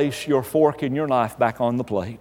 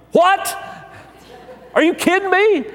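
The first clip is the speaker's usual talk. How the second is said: much higher, indignant shock; outraged disbelief; 13 semitones higher than usual. Acted. Husband reacts to wife delaying the steak, shocked and indignant. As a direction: blurt it out like you've been robbed.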